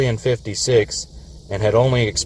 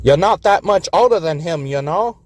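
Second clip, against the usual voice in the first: higher, wry aside; skeptical engine; 7 semitones higher than usual. A narrator's reading. New York teasing Governor, wry and higher. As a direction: needle him a little. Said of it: "looked sideways at Governor".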